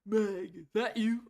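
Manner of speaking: attempted old man voice. Shown as a demonstration. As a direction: take a shot at an old man's voice. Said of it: "let me see if I can do a".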